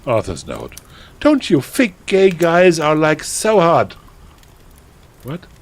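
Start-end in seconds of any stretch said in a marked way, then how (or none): 1.2-4.0 put-on high, loud, gushing teenage voice; melodramatic teen author. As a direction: read it like an overexcited goth girl. Reads author's note in character.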